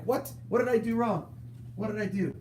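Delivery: high, put-on hurt cat voice; cat's voice. Streamer voicing his cat being picked up, high whiny protest. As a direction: voice the cat as wounded innocence. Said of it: "He's like".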